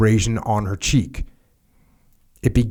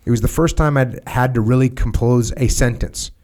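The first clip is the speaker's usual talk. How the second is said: ordinary delivery, nothing marked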